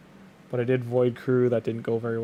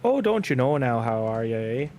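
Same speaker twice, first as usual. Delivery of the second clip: mock Saskatchewan Canadian accent, louder at the start; lightly imitating the slower Canadian accent; slow prairie Canadian accent; 5 dB louder than usual. Streamer imitates Saskatchewan speakers in a Canadian accent. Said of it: "have a slow, like, an, it's, the accent's a little slow".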